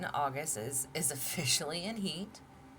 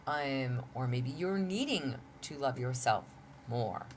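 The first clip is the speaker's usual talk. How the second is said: ordinary talk, nothing marked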